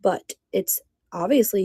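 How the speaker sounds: ordinary talk, nothing marked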